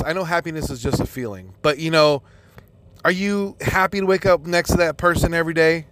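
ordinary delivery

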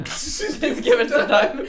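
high, slow, laughing echo; laughing mockery. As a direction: repeat it in disbelief through laughter. Echoing the doctor, mocking.

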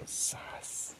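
breathy scared whisper; tiny whispered oh-no; whispered gulp. Retold or imitated. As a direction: mouth it under your breath in dread. Scared reaction.

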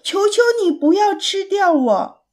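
high, desperate begging; pleading cry. A narrator's reading. Little pig begs the monster for his life, pleading cry. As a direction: call out high, begging to be spared. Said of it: "艾利克斯大叫".

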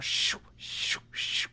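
soft, breathy shoo sounds for a rubbing wheel; bike noise imitation. Son copying his bike's noise for dad, soft whooshing. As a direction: mimic the whir of a bent bike rim quietly. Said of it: "making this fucking noise".

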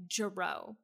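low, soft deadpan correction. Retold or imitated. Co-host mimics the wife correcting her surname, deadpan. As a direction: drop it low, case closed.

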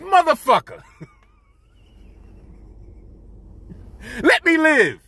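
exasperated retort. Retold or imitated. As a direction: throw it back at him, half laughing. Exasperated retort to barbershop teasing.

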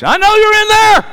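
high, loud mock call at the door; imitated coach calling through the door. Coach at the door calls to the hiding family, mock knowing call. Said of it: "It's Coach".

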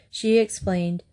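ordinary delivery, nothing marked

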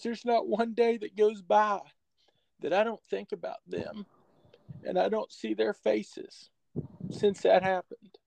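put-on a touch of a quavering, tearful old coach; retold tearful coach. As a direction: hold back tears as you quote him. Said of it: "he fought back tears".

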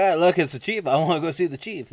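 high, loud eager fan voice; acted-out giddy excitement; all excited. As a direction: burst out like an overexcited recruit. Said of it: "One guy's all excited".

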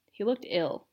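plain reading voice

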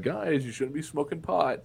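friendly reminder to friends; gentle nudge. Retold or imitated. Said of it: "it went from".

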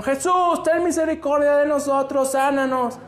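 high, in unison, really calling out; earnest plea. Shown as a demonstration. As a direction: call out together for mercy. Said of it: "ellos sí gritaron de a de veras". (maestra mostrando cómo gritaron de verdad)

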